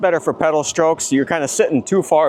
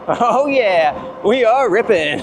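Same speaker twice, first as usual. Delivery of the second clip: excited; 6 semitones higher, much slower than usual. Real, not acted. Thrilled at top speed.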